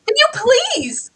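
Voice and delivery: high and loud in disgust; disgusted outburst. Retold or imitated. Cohost tells the creep to leave, loud high shriek. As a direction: shriek at him to back off. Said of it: "I was like".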